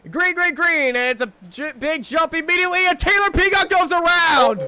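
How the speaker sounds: shouted play-by-play, building to a peak; excited race call. Real, not acted. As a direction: call the crash at full, high-pitched shout. Race restart turns into a crash.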